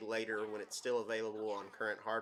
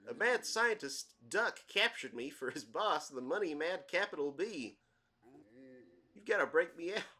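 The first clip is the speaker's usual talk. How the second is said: higher-pitched, swooping cartoon character voice; Bullwinkle; 6 semitones higher, 4 dB louder than usual. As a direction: play a goofy talking book, pitched up and louder. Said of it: "a Bullwinkle voice".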